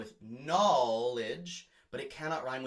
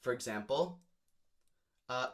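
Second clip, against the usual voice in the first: ordinary delivery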